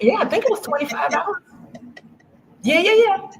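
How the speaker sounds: high laughing yeses; laughing along, pitch up. Real, not acted. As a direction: giggle through the words. Laughs while confirming.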